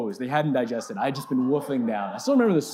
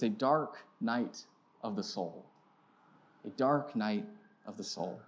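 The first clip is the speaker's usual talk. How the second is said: solemn; 4 semitones lower, 6 dB quieter, slower than usual. Real, not acted. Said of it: "slow solemn repetition".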